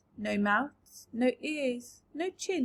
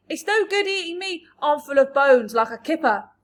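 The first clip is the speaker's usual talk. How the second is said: high, loud pleading cry; frantic shout; 3 semitones higher, 10 dB louder than usual. A narrator's reading. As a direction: protest loudly. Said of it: "shouted the centipede".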